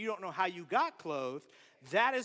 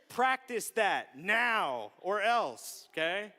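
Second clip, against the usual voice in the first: slow, loud, weighted order; parental warning; 6 dB louder, much slower than usual. Retold or imitated. Dad warning his squabbling kids, slow and firm. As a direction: lay down the law, word by word.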